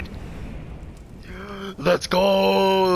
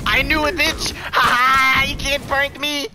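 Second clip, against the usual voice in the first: higher, loud gloating; excited gloating; 9 semitones higher, 4 dB louder than usual. Real, not acted. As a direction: crow over the read. Reads the opponent's trick.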